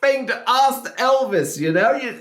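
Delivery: high, laughing disbelief; exasperated laughing. Real, not acted. Reactor outraged at interviewer's question to [name], high laughing disbelief.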